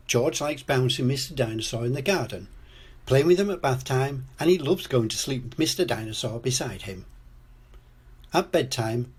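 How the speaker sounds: plain reading voice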